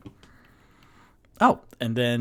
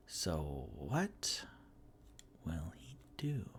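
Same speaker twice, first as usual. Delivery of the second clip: hushed suspense; 6 semitones lower, 15 dB quieter, much slower than usual. Acted. Stalling, dramatic hush.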